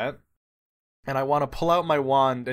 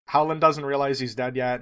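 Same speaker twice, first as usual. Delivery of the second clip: ordinary talk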